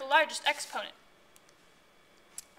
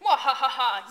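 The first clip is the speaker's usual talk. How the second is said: evil laugh; 6 dB louder than usual. Acted. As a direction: cackle like a mad scientist. Villain Doctor Exponential evil laugh.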